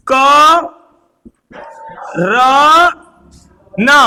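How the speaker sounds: syllables drawn out one by one; drawn-out Karan. Shown as a demonstration. Teacher drawing out करण for the class. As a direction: draw out क, र and न slowly, separated. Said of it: "मैंने खींच के बोला करण".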